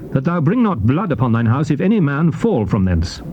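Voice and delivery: ordinary delivery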